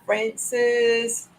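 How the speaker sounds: usual speaking voice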